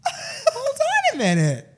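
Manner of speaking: high, loud, laughing hold-on; cracking up in disbelief. Retold or imitated. Retelling the driver's flirt, he laughs out a high stunned hold-on.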